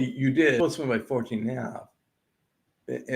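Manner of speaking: ordinary talk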